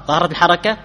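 normal talking voice

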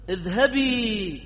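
"اذهبي" with a long, drawn-out final ee; stretched final ya. Shown as a demonstration. Teacher shows class the word takes a ya, drawn-out final ee on "اذهبي". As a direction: say "اذهبي" slowly, holding the last ee. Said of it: "ممكن أن تقبل ياء المخاطبة".

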